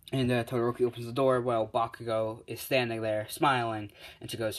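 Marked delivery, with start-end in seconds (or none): none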